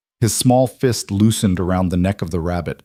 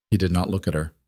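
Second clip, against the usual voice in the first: ordinary delivery, nothing marked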